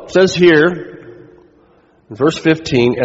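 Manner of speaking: ordinary delivery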